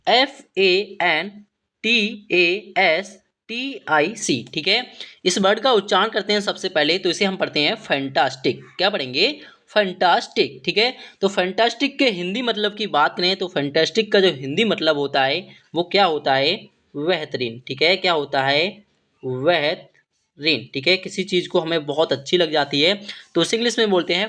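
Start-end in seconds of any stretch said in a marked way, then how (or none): 0.1-4.4 slow, spaced-out spelling; letter by letter. Shown as a demonstration. Teacher spelling the next word for students, letter by letter. 19.2-20.7 बेहतरीन drawn out in two parts; slowly broken up. Shown as a demonstration. Teacher repeating the Hindi meaning for students, slowly broken up.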